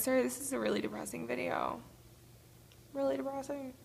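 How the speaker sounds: shaky, tearful sorry; tearful apology. Real, not acted. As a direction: apologize while still weepy. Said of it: "And I cried".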